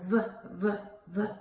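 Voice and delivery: "V" as a short vuh, not the letter name; letter sound demo. Shown as a demonstration. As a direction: sound out "V" in separate bursts. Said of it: "Vincent Vampire Bat says".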